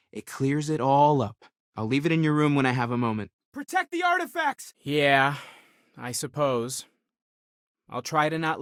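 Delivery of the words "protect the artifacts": high-pitched urgent command; urgent call. Acted. Urgent order called out.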